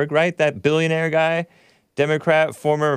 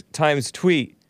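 usual speaking voice

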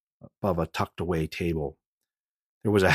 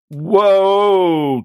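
startled shout; 12 dB louder than usual. Acted. Gun pointed at his head.

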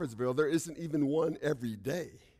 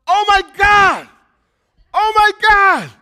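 yelled in shock; 13 semitones higher, 17 dB louder than usual. Retold or imitated. Old friend recognising the man who got him sober, shouting. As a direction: jump up and bellow it in disbelief.

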